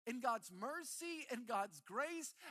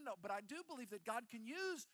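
ordinary talk

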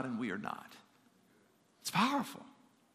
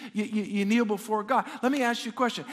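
ordinary talk

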